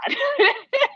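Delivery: high-pitched, spontaneous laugh. Real, not acted. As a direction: let out a real, high laugh. Laughs after her remark.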